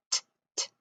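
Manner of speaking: "t, t" as two unvoiced taps, apart; isolated T sound. Shown as a demonstration. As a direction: say the sound "t" twice, no vowel. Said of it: "And then we hear".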